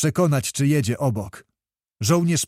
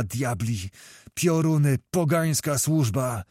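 ordinary delivery

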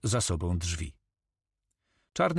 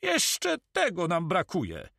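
high, drawn-out outcry; exasperated cry without extra volume; exasperated exclamation; 10 semitones higher, slower than usual. A narrator's reading. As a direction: exclaim it slowly at a raised pitch. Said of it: "wykrzyknął Karma".

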